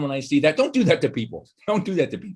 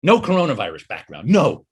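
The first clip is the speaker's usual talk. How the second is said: ordinary talk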